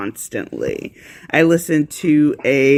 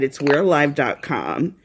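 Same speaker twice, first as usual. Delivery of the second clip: ordinary talk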